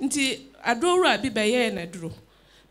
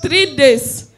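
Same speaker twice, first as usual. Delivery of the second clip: loud emphatic; 2 semitones higher, 8 dB louder than usual. Real, not acted. Testifier repeats after pastor.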